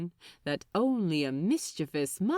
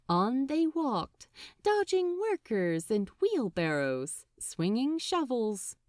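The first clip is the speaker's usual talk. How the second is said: ordinary delivery, nothing marked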